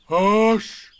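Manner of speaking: loud high booming hush; terrible voice. Acted. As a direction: blurt a dreadful hush. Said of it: "said Eeyore in a terrible voice".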